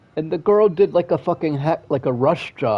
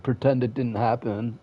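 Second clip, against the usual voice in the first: ordinary talk, nothing marked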